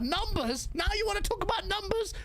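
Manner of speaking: high, indignant questioning; incredulous challenge. Real, not acted. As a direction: throw the word back in rising disbelief. Throws rivals' word back at them.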